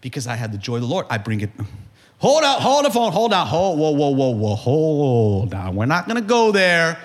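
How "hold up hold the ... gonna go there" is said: hold on, calm down. Acted. Calming mock heated family quarrel.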